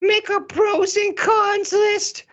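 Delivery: very high, eager drama queen. Acted. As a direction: chirp it like a busy diva. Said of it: "this is my drama queen voice".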